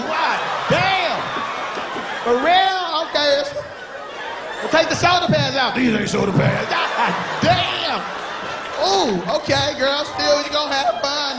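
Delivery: high, mock-surprised girl voice; shocked fake friend. Woman reacting to her friend's outfit, high mock voice. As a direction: gasp then force cheer.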